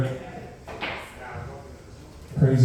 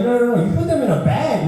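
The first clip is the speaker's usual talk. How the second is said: laughing, higher pitched correction; 7 semitones higher than usual. Retold or imitated. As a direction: explain through laughter. Explaining while laughing.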